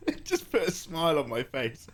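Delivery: laughing. Real, not acted. Laughing about chat mixup.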